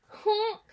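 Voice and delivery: loud, high nasal honk of a snort-laugh. A narrator's reading. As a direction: blast it out like a goose honk. Snort-laugh sound effect.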